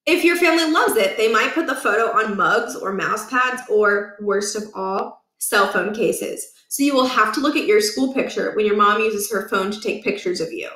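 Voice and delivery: plain reading voice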